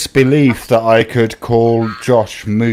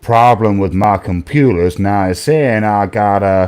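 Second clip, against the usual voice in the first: a lightly put-on Southern gentleman accent, lower; 3 semitones lower than usual. Playing a Southern gentleman calling about his computer, mild accent. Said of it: "generic Southern gentleman accent".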